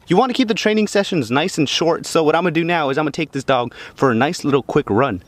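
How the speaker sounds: normal talking voice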